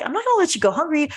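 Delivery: quick, high-pitched insistent quote; retold kindly insistence. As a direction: imitate a cashier who won't take no. Retold cashier insisting on feeding him.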